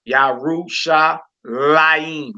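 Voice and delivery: "Yerushalayim" very slowly as yah-roo-sha-LA-eem; stress on LA. Shown as a demonstration. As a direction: break up "Yerushalayim" and lean on "la". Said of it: "We put our emphasis over the Lamed".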